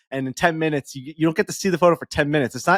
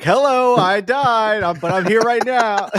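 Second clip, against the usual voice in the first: much higher and louder, a cheery put-on voice; uncle's ghost voice; 9 semitones higher, 4 dB louder, slower than usual. Uncle greeting the family from heaven, high and loud put-on voice. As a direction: ham up a chirpy voice from beyond the grave. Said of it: "He's like".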